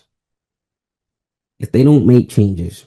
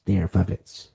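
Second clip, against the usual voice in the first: "They are puppets" softer, dropped low; quiet repeat; 3 semitones lower, 7 dB quieter than usual. Shown as a demonstration. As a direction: let "They are puppets" fall away.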